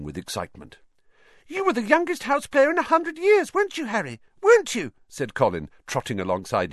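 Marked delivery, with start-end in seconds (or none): 1.5-4.9 very high, loud piping squeal; thrilled schoolboy fan. A narrator's reading. Colin begs to tag along to practice, thrilled. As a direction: burst with excitement at your hero.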